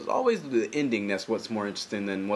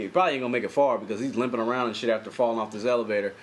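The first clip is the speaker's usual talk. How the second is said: ordinary delivery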